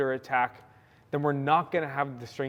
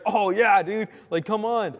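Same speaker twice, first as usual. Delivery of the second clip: higher, much louder eager burst; hyped up; 7 semitones higher, 5 dB louder than usual. Retold or imitated. Josh excited to show his guns, hyped and loud. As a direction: fire it out like an eager friend. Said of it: "got hyped".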